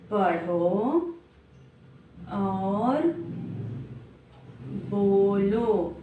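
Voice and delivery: पढ़ो और बोलो drawn out with long gaps; slow, word by word. Shown as a demonstration. Teacher drilling pupils, slow deliberate words. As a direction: say each word slowly like a drill.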